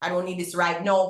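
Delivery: ordinary talk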